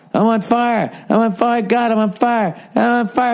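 high, repeated cries of burning; burning soul. Retold or imitated. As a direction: wail that you're on fire. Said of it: "Then if you're in hell".

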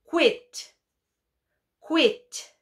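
"quit" said high and crisp, the qu clear. Shown as a demonstration. Teacher dictates quit clearly to the class. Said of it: "dictation word said clearly".

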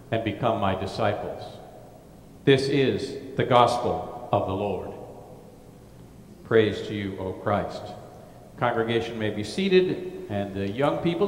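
normal talking voice

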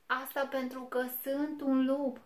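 high, loud little-wolf voice; a small wolf insisting proudly; thin little voice. A narrator's reading. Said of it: "cu vocea lui subțirică".

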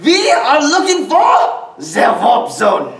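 menacing German baddie, drawn out slowly; German villain voice. Die Hard parody villain hunting the Warp Zone, high aggressive German accent. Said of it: "play it up here a little bit higher and be more aggressive".

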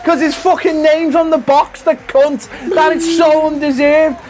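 mock outrage rant. Real, not acted. Loser ranting at his friend about Mario winning, mock furious. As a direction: rant like you've been robbed, half joking.

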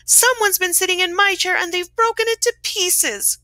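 high, hammed-up little bear wail; crying Baby Bear. A narrator's reading. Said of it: "cried the Baby Bear".

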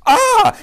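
high, loud scream into the night; terrified shriek. Acted. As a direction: shriek again in panic. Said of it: "he shrieked into the night".

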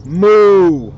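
long, loud mooing; cow moo. Acted. Reading to kids, voicing the cows: long loud moo. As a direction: bellow it like a cow.

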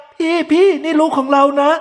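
put-on wife pleading with her husband over their baby. A narrator's reading. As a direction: beg him for the child. Wife pleading in his imagination.

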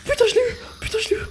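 very high, breaking cry of relief; shrieking relief. Real, not acted. Gamer cries out after surviving the creeper. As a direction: shriek the win out.

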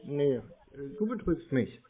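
ordinary delivery

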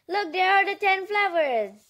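plain reading voice

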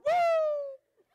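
high loud whooping cheer. Retold or imitated. Congregation cheering the word with a high whoop. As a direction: let out a joyful whoop.

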